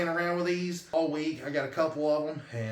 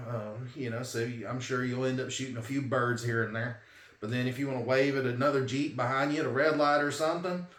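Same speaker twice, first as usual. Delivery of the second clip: normal talking voice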